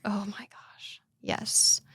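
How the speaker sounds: hushed whispered admission; whispered oh my gosh. Real, not acted. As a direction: drop to a whisper, sheepish. Admitting husband's anger, hushed.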